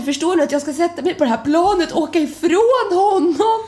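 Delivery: half-sob it in distress; retold crying panic. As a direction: Frida tearful about leaving her boyfriend behind. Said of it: "hyperventilerar, gråter".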